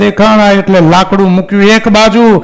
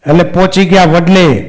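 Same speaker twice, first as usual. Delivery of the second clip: ordinary delivery, nothing marked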